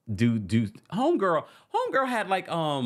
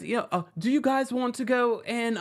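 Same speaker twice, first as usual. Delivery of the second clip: put-on failed Scottish accent; 10 semitones higher than usual. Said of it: "I can't do her accent".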